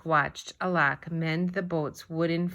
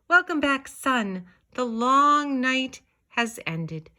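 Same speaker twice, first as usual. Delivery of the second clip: joyful greeting; 8 semitones higher, 5 dB louder than usual. Acted. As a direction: greet the sun like a long-lost friend. Children's written welcome to sun.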